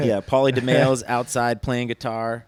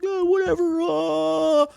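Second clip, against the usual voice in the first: much higher falsetto aw-shucks; mock modest; 4 dB louder, slower than usual. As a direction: play it falsely humble. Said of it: "I'm like".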